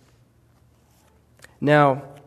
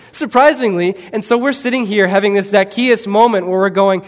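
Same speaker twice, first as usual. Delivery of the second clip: ordinary talk, nothing marked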